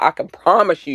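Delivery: normal talking voice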